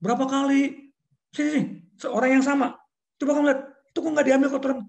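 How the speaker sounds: high, loud, fast scolding; exasperated boss; angry boss. Retold or imitated. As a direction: hurry him over and scold. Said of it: "Marah-marah saya".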